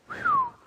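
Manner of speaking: long high exhaled whew of relief; relieved whew. Real, not acted. Preacher remembering his brother's support, relieved high whew. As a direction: let the relief out in one high breath.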